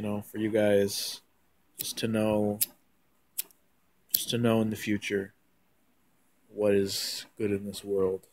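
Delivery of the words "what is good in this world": ordinary delivery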